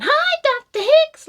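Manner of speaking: high, loud, slow drunken cartoon hello; pissed students hailing their professor in a cartoon voice; Simpsons-style greeting. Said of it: "Like from the fucking Simpsons".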